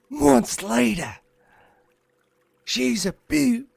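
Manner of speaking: high, drawn-out mock Australian accent; Steve Irwin. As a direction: ham it up as a slow, excited Aussie croc hunter. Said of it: "you cannot say ew to Steve Irwin".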